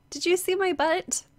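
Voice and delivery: high, stretched-out question; puzzled, oddly drawn-out question; said kind of weirdly. Real, not acted. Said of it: "I said that kind of weirdly".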